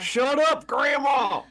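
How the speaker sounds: high, loud mock shout at Grandma; mock yell. Yelling at grandma over pipes.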